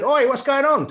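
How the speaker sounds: high, loud English "oi" shout; very English accent. Retold or imitated. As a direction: call out in a broad English accent. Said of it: "in a very English accent".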